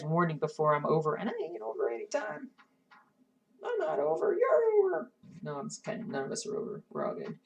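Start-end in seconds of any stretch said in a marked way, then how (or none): 3.6-5.1 high, sing-song tease; playful teasing. Pretend. Teasing viewers about ending.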